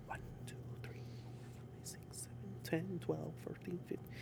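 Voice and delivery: hushed rapid whisper counting. Retold or imitated. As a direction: whisper the count under your breath.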